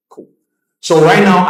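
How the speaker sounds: normal talking voice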